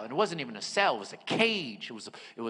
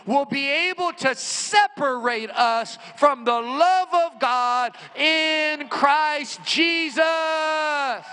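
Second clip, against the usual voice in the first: slow, high, loud proclamation of scripture; a slow booming shout up to the top floor; yelled scripture; 9 semitones higher, 5 dB louder, much slower than usual. Retold or imitated. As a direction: call it out across the cellblock, word by word. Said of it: "He yells this out".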